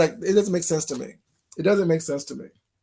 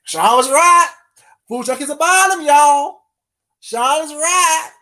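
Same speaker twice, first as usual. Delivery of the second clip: mock admission; 6 semitones higher, 6 dB louder than usual.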